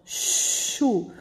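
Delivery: "chu" with a long hushing sh; drawn-out sh sound. Shown as a demonstration. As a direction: stretch the sh at the start of "chu". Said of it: "Olha o som".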